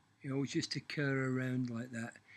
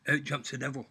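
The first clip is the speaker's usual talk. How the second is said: normal talking voice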